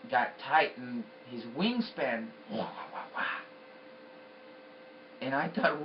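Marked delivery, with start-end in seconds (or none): 2.5-3.2 low, breath-only whooshing wah-wah; soft unvoiced whoosh effect; imitated wingbeats. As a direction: imitate big wings beating with whispered bursts. Imitates wings wrapping around.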